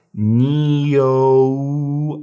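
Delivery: "neo" stretched out slowly, gliding ee-oh-oo; slowed-out pronunciation. Shown as a demonstration. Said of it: "this would be pronounced".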